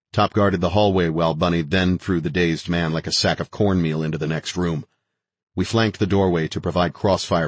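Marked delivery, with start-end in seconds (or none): none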